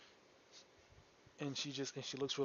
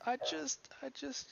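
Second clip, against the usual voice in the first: put-on high girl's voice, stuck on the words; a hint of crying in a high young voice; girl too upset to go on; tearful young girl; 13 semitones higher, slower than usual. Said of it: "she starts to cry a good bit".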